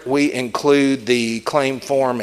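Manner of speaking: ordinary delivery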